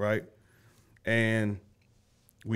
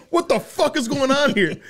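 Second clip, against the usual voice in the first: mock angry yell; 12 semitones higher, 3 dB louder than usual. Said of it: "he's had enough".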